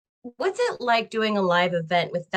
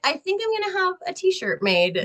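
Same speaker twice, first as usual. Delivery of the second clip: much higher, teasing put-on voice; mock boastful author; 11 semitones higher than usual. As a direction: play the proud bestseller author for a laugh. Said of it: "You're like".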